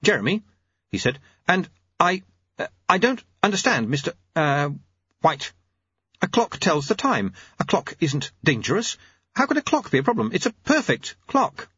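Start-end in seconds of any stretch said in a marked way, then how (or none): 0.0-0.4 high, uncertain clockmaker; timid high voice. A narrator's reading. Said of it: "The clockmaker backed away". 1.5-11.7 high, flustered, stammering clockmaker; bewildered high-voiced inventor; Jeremy. Voices Jeremy, backing away bewildered.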